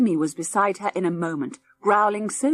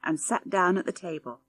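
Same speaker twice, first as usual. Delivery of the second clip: ordinary delivery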